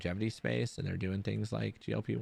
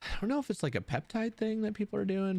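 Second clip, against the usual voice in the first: high-pitched confusion; 13 semitones higher than usual. Real, not acted. Pitch jumps high, unsure.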